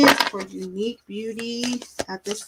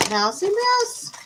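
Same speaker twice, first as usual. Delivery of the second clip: high, slow playful name call; singsong call; 10 semitones higher, slower than usual. Acted. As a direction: croon the nickname sweetly. Playful singsong calling viewer's name.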